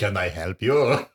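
slow, put-on French accent; hammed-up French-accented greeting. Shown as a demonstration. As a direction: draw the question out in a mock French accent. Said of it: "What's a French?".

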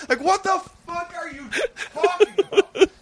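cracking up in disbelief; laughing disbelief. Real, not acted. Laughing at friend's absurd idea.

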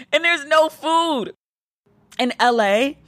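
mock-dramatic lament; hungry outcry. Real, not acted. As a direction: cry out the tragedy of an empty fridge. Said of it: "I sound way too excited about food".

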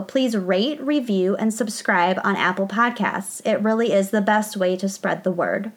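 bright, steady announcer read of the credits; podcast outro read. Acted. Host signing off to listeners, announcer outro read. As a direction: read the sign-off like an ad.

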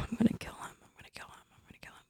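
whispered muttering under the breath; exasperated whisper. Retold or imitated. Wife muttering to herself about her husband over dinner, exasperated whisper. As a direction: seethe privately in a barely audible, repeated whisper.

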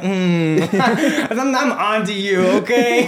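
high, loud, laughing mock suspicion. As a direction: tease as if you've caught him. Playing suspicious of a nice guy.

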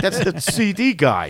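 high, slow put-on voice with a laugh; mimicked audience member. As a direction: point out the loser, drawn out and mocking. Mimicking audience spotting the seller.

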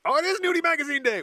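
high, loud, fast mock announcement; excited. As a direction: announce it like big news. Mock announcement of special day.

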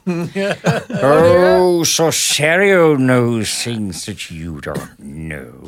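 slow, arch theatrical villain; Jonathan Harris voice. Retold or imitated. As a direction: drawl it out like a fussy wizard. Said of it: "he sounds like Jonathan Harris".

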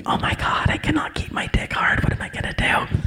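unvoiced frantic whisper close to the ear; hushed panic under his breath; panicked whisper. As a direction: hiss it so the audience can't hear. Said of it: "but he's like".

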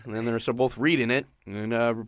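usual speaking voice

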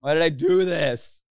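high, loud comic despair; acted panicked lament, drawn out; mock anguished wail. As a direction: wail it in hammed-up regret. Acts out regret after the manic high.

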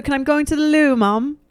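put-on high-pitched British child; mock British accent. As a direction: play an English kid asking Mum. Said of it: "I have a great accent, as you can tell".

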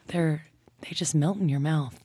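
normal talking voice

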